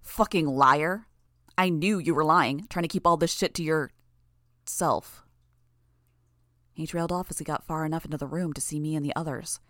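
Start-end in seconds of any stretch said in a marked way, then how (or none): 0.0-5.2 angry spit. Acted. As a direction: spit the words out in anger. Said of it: "The guy behind him spat".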